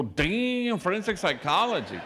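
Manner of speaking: high, loud, amazed repeat; impressed. Retold or imitated. Priest impressed by the girl's plan, loud surprise.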